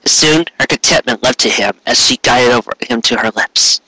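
ordinary delivery, nothing marked

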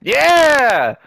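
high, loud triumphant yell; excited shout. Real, not acted. Celebrating as his friend jumps on, loud yell. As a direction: cheer like you just nailed it.

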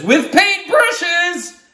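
high, slow loud reveal; excited octopus. Acted. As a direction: announce it gleefully. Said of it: "the octopus says".

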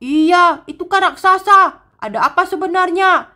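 terrified of the giant, telling Mio; frightened. Acted. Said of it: "Aku takut".

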